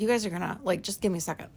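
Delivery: normal talking voice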